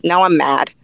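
ordinary talk

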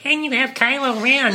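high, loud whiny villain voice; Kylo Ren. Acted. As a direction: whine it loudly as a bad impression. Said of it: "terrible impression of both of them".

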